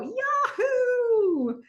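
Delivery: very high, long-held cheer; exuberant whoop. Retold or imitated. Donna standing up to cheer Tripp on, exuberant. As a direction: let the Yahoo soar and fall. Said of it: "Donna stands up".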